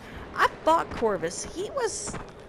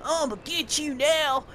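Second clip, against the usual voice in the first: higher, lightly voiced hillbilly villain taunt; generic bad guy taunt; 5 semitones higher than usual. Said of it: "he was doing this".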